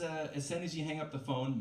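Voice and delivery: ordinary talk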